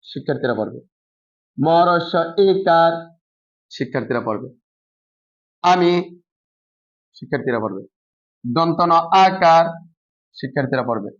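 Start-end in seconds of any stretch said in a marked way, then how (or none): 1.6-2.9 slow spelling out. Shown as a demonstration. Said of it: "বানান উচ্চারণ আলাদা আলাদা কিভাবে". 8.4-9.7 drawn-out letter by letter, like a teacher at the board; slow spelling out. Shown as a demonstration. Teacher spelling a word for pupils to repeat, slow and deliberate. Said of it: "বানান উচ্চারণ আলাদা আলাদা কিভাবে".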